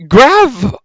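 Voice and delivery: high, slow disbelieving "what combo"; incredulous. Real, not acted. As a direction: scoff in disbelief. Incredulous at teammate call.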